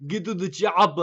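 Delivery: put-on loud Austrian-accented action-hero yell; Arnold Schwarzenegger. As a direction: bellow it in a thick Austrian accent. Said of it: "I kinda sounded like Arnold Schwarzenegger".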